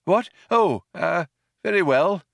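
put-on high, hesitant old man; falter, caught off balance, pitched high; slightly taken aback. A narrator's reading. Old man is dismissed by Benji, slightly taken aback. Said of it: "slightly taken aback".

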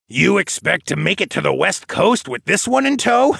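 high, mocking question ending in a snicker; snickering jibe. A narrator's reading. Grandfather mocking the travellers' westward plan, snickering. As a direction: tease with rising disbelief, then snicker. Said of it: "Darwin snickered".

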